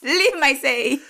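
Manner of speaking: high, grandly hammed-up order; theatrical stage voice. Co-host extending the mother impression, theatrical stage voice. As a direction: imitate an old-fashioned stage heroine. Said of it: "It sounds like a stage voice".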